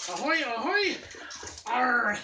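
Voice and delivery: loud pirate hail; pirate call. Acted. Pirate greeting.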